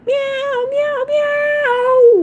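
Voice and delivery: very high sung cat meows. Pretend. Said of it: "You guys need some cat sound effects?".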